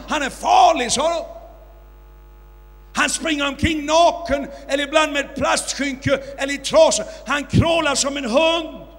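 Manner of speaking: loud, high warning cries; alarmed crowd shout. Retold or imitated. Crowd warning the preacher about the monkey man, loud and alarmed.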